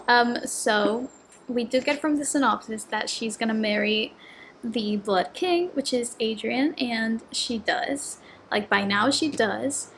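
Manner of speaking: usual speaking voice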